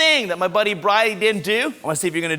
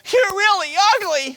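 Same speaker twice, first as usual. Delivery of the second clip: mock bully taunt; 10 semitones higher, 3 dB louder than usual. Shown as a demonstration. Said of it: "come up to me and go".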